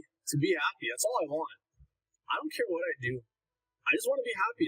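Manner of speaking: ordinary talk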